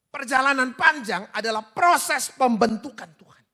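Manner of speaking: high, booming sermon delivery; fervent preaching. Real, not acted. Preaches at full force.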